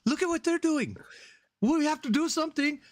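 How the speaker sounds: put-on high-pitched, childish squabble; silly high voices passing the buck; mock bickering guards. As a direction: imitate flustered guards in a high, comic voice. Retold squabble of border guards.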